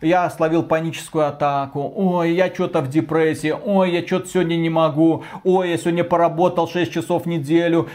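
ordinary delivery

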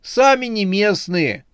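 high-pitched pleading wail; desperate cry. A narrator's reading. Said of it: "в отчаянии крикнул кто-то". (отчаянно, нараспев)

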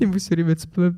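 normal talking voice

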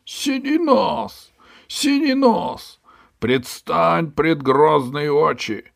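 high, slow, loud summoning call; guard shouting. Acted. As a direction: bellow the name across the beach. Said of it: "один из стражников закричал". (громкий протяжный крик стражника)

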